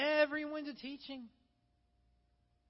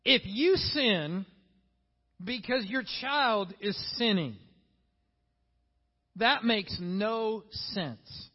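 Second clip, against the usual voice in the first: slow, louder, each word weighted; mentor's firm slow rebuke; 8 dB louder, slower than usual. Retold or imitated. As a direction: lay it down slowly like a mentor. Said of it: "And he goes".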